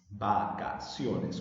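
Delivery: Vacaciones drawn out slowly, va-ca-cio-nes; "Vacaciones" in separate, evenly spaced syllables. Shown as a demonstration. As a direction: break "Vacaciones" into four slow syllables. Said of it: "separar en sílabas".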